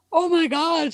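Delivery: high-pitched retold amazement; imitating impressed woman. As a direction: gush like an impressed female onlooker. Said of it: "this one chick, she's like".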